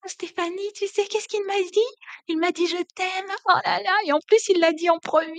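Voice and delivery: a smitten woman phoning her friend to gush; gushing girlfriend. Pretend. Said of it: "Vous décrochez rapidement votre téléphone".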